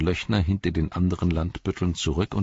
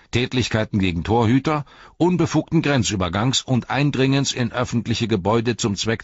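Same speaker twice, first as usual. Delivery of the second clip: higher and louder, reeling off a list of offences; pompous captain reading charges; 9 semitones higher, 6 dB louder than usual. Acted. Captain arresting Frodo, pompous and officious. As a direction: recite the charges like a proud petty bureaucrat.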